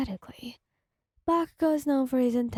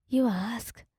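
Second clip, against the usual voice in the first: ordinary delivery, nothing marked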